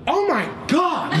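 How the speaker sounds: slow, high, amazed Oh my God; woman stunned her pain is gone; amazed cry. Retold or imitated. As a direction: gasp it out in wonder. Woman amazed after healing.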